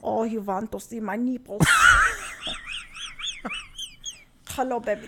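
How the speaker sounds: a put-on Dutch model voice; Dutch accent. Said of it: "Could you please do the, uh, uh, the accent you did".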